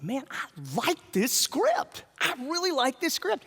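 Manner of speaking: eager, rising quote; acted enthusiasm. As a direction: gush about it, stretching the words. Acts out his eager praise.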